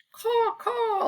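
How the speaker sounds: high, drawn-out crow caw; croaking crows. A narrator's reading. Reader recapping the crows' noise, cawing. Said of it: "The crows croaked".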